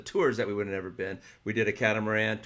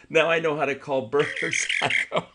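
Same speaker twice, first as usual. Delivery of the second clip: normal talking voice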